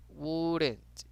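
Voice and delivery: "wouldn't" stretched slowly, no L sound; drawn-out silent L. Shown as a demonstration. Teacher repeats the word for students, drawn out. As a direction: draw out the vowel of "wouldn't", L silent. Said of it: "no la pronunciamos".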